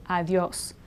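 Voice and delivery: Adios as ad-yos, two syllables. Shown as a demonstration. Teacher modelling two-syllable Adios. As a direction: adios blended, ia as ya. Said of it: "it's two".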